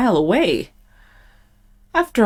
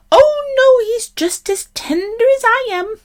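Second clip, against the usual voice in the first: very high, squeaky little goat. A narrator's reading. Little goat coaxing the troll, very high voice. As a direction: reassure the troll in a tiny high voice. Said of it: "in his little bit of voice".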